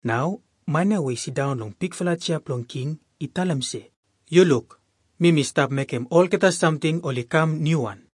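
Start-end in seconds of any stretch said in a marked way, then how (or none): none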